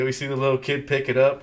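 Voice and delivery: usual speaking voice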